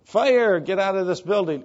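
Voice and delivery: urgent shout. Shown as a demonstration. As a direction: yell it like the building is burning. Demo shouting a fire warning.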